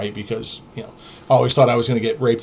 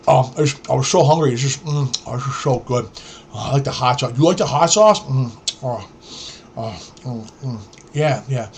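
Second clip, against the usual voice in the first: mumbling through imagined bites of burrito; mock mouth full. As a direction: savour food while talking, with mms and ohs. Imitating friend eating a burrito.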